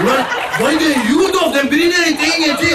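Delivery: high and loud complaining; frustrated yell. Retold or imitated. Uncle complaining loudly that the grill won't light. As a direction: shout over the grill that nothing burns.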